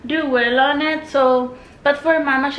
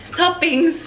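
loud, higher, amused "Toppings."; amused exclaim; 6 semitones higher, 5 dB louder than usual. Real, not acted. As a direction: burst out "Toppings." laughing at the slip. Laughs at her own slip.

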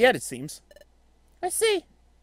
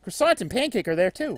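ordinary talk

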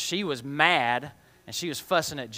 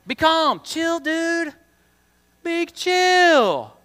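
much higher, louder laid-back surfer-dude voice; hammed-up chill guy telling you to relax; 13 semitones higher, 7 dB louder, much slower than usual. Teacher jokingly voicing calm advice to kids, hammed-up dude. Playful Jesus says be calm.

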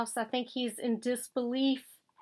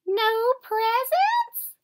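high, slow, loud dismayed squeak; 11 semitones higher, 7 dB louder, slower than usual. A narrator's reading. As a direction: let the disbelief stretch out. Mouse shocked.

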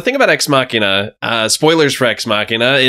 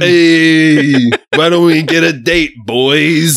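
bro voice; 3 semitones higher, slower than usual. Acted. Macho bro call-out.

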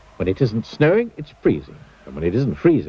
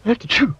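sharp high achoo; loud sneeze; 13 semitones higher, 3 dB louder than usual. Acted. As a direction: sneeze it out big. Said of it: "a noise that sounds like this".

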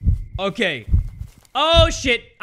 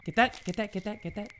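hushed urging; 8 dB quieter than usual. Real, not acted. Urging while hiding.

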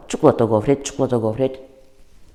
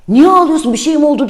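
much higher, louder, worried questions; alarmed uncle; 12 semitones higher, 5 dB louder, faster than usual. Retold or imitated. Uncle on the phone hears the girl crying, alarmed and much higher. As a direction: sound suddenly panicked for the child. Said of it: "Telaşlandı".

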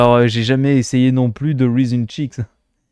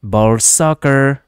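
mock English, drawn out; 4 dB louder, much slower than usual. Second slow take, louder.

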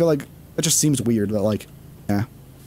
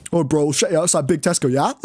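playful mock roadman banter; British roadman accent. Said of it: "those are the two British".

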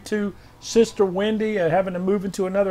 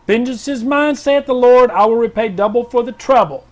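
raised, ringing declaration; prophetic proclamation; 4 semitones higher, 5 dB louder than usual. Acted. Pastor reading a viewer's prophetic word, proclaiming.

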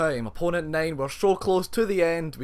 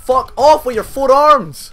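loud, slow, higher raging shout; slow furious yell; 8 semitones higher, 12 dB louder, slower than usual. Real, not acted. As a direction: spit each word at the four-armed boss. Raging at Goro.

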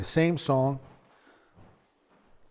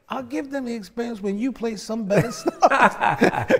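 laughing; 8 semitones higher, 7 dB louder than usual. Retold or imitated. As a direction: laugh through the teacher's jab, louder. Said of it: "He was like".

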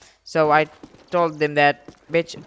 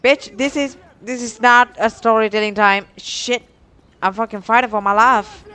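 frantic; 5 semitones higher, 6 dB louder than usual. Real, not acted. Frantic in a gunfight.

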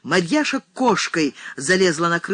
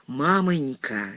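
ordinary delivery

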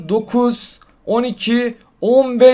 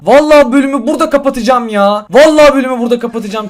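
louder, real frustrated threat; fed-up outburst; 3 semitones higher, 7 dB louder than usual. Real, not acted. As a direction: vent real anger at the game. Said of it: "Abi çok sinirlendim şu an ya". (oyuna sinirlenmiş, yüksek sesle söylenme)

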